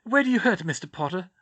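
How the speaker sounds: a fussy teacher out of her depth; lightly voiced flustered teacher. Sprout asking gently.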